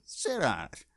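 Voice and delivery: a light Fonz brush-off; Fonz impression. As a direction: toss off the Fonz line. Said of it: "Just my normal voice".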